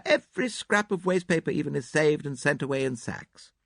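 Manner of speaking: put-on high, fluttery elderly lady; gushing hostess, a little theatrical; Mrs Inglethorpe. A narrator's reading.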